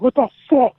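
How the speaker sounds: loud, high-pitched angry exclamation; retold outraged shout. Wrestler standing over the woman who threw a bottle, retold outraged shout. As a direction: act out an outraged yell.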